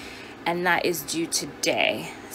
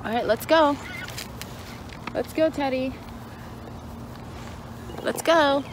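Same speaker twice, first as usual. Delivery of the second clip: high cheery call; 7 semitones higher, 4 dB quieter than usual. Real, not acted. Calling dog to walk.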